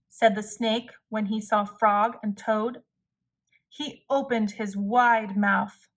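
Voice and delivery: plain reading voice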